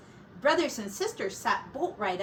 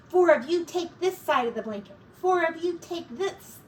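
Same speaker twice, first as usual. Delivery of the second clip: urgent whisper; 7 semitones higher, 4 dB louder than usual. Acted. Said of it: "hissed Sorrel".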